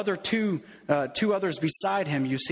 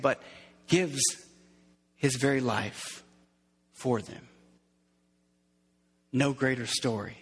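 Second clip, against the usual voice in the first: solemn preaching; 4 semitones lower than usual. Acted.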